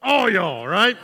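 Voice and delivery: All y'all in a broad Southern drawl, loud and high; Southern twang, all y'all said big and proud; Southern emphatic plural. Shown as a demonstration. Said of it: "we have an emphatic plural".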